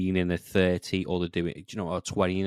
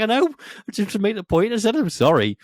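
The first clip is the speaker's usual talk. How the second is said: defensive; 13 semitones higher, 6 dB louder than usual. Retold or imitated. Retold defensive reply.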